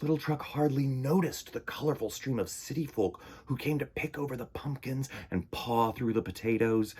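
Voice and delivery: ordinary delivery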